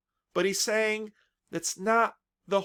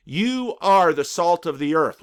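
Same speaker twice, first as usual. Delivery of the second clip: louder, "are" driven home; emphatic declaration; 6 dB louder than usual. Acted. Jesus refusing to be edited, loud emphatic. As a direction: proclaim it with no hedging. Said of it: "Jesus won't be edited".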